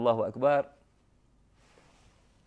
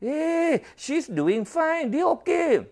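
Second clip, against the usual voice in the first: much higher, brisk nurse urging push; nurse's brisk voice; 10 semitones higher, slower than usual. Retold or imitated. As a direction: chirp like a bossy nurse at the bedside. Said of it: "dia kata".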